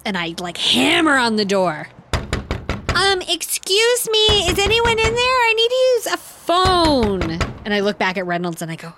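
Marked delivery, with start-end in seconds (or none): 2.9-7.2 polite high pleading voice. Acted. Banging on the door for help.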